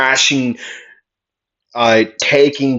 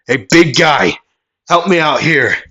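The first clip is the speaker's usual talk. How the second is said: raised voice, hailing a partner; calling for help; 4 semitones higher than usual. Acted. Villain calling his big partner into the fight, voice raised. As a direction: call over to the big guy.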